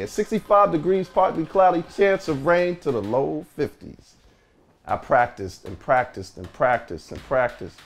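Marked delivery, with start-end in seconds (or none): 0.0-4.2 upbeat DJ weather read; radio announcer. Acted.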